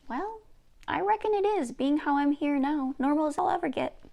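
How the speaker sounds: high, matter-of-fact folksy voice; hands-on-hips reckoning; Nukamis. Acted.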